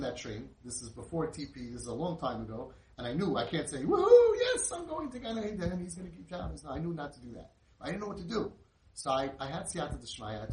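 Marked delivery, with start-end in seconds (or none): 3.8-6.6 huge high whoop, easing as it goes; mock jubilation. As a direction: burst into a loud, over-the-top victory cheer. Imagined cheer he knew to hold back.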